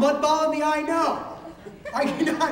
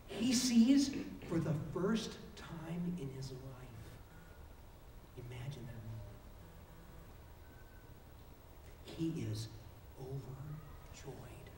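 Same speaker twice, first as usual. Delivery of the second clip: hushed awe; 12 semitones lower, 16 dB quieter, slower than usual. Acted. Preacher hushes at the miracle.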